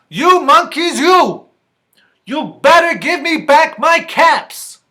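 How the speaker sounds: loud, high-pitched acted shout; quite angry peddler. Peddler stamps and warns monkeys, angry storybook shout. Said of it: "Now he felt quite angry".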